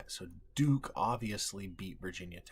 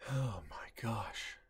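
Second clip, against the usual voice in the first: whispering; 4 dB quieter, slower than usual. Real, not acted. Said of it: "I gotta be careful".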